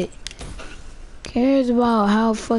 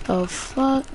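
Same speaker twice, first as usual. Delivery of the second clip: usual speaking voice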